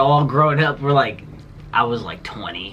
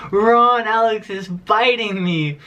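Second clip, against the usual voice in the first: mock grandma calling out; 7 semitones higher, slower than usual. Grandma calling to Ron about the bite, hammed-up higher plea. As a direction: play the grandma crying out to her husband. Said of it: "She goes".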